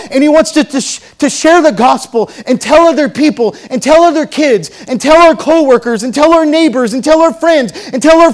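loud, high, cascading sermon list; full-force preaching. Real, not acted. Preacher urging congregation to share the gospel, loud and fervent. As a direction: keep piling it on, fervent and unbroken.

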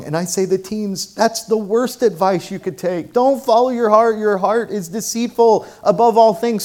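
loud, high, emphatic preaching; fervent pulpit preaching; preaching at full force. Acted. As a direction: drive the sermon home to the congregation.

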